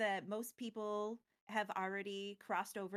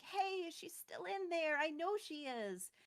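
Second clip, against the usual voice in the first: higher, slow pleading protest; a mild mock protest; protesting Chandra; 7 semitones higher than usual. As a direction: plead that she's still inside. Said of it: "protesting, like".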